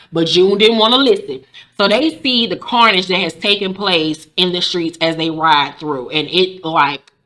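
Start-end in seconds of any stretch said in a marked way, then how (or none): none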